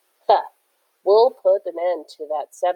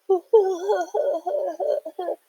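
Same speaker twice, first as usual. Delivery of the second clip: high jeering laugh fading off; distant mocking laugh; 13 semitones higher than usual. A narrator's reading. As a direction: laugh at the fleeing kids. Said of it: "hear them laughing at us".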